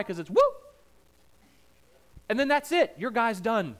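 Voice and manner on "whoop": imitated zoom of a racing car; quick high whoosh of the car shooting by; whoop sound. Mimicking the car blasting down the strip, louder sound effect. Drag car zooping past.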